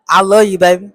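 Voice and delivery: loud smooth lover voice; suave loud. Retold or imitated. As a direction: belt it like a smooth talker. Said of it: "my suave ass".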